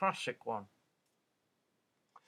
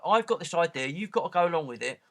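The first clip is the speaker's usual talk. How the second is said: louder, faster bossy insistence; mock insistent preacher; 6 semitones higher, 8 dB louder than usual. Author paraphrased pushing his idea on readers, mock insistent. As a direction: push the idea on them, a little hammed-up.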